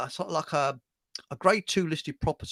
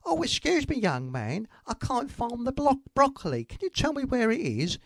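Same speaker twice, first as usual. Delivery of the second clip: high put-on old lady voice; woman shopper; 7 semitones higher, 4 dB quieter than usual. Joke teller voicing the woman pestering the shop boy, high mock lady. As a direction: squeak it out like a fussy little old lady. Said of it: "she comes back and says".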